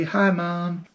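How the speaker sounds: put-on high, bright little boy greeting; cheerful kid voice. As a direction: chirp it like an eager child. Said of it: "he always has this feeling of".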